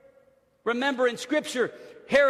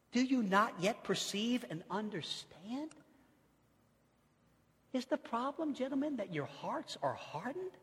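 gentle Jesus; 11 dB quieter than usual. Acted. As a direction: ask it gently like a patient teacher. Quiet tender reading of Jesus.

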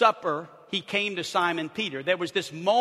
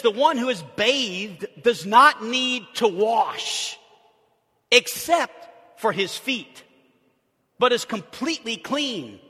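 slow weighty quote; 4 semitones higher, 7 dB louder, slower than usual. Acted. Slow emphatic quoting.

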